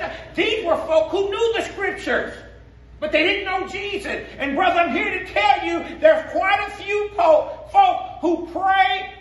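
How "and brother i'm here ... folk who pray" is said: preaching shout. Real, not acted. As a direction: build to a full preacher's cry. Preaching climax.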